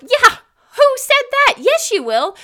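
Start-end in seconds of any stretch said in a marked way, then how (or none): 0.8-1.5 mock indignant. Mock challenge to imagined claim.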